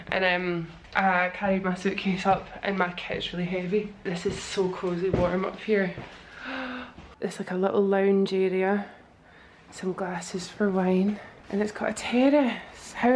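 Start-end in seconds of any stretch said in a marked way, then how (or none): 6.4-7.1 sharp surprised intake of breath; delighted gasp. Real, not acted. Sees the lounge area.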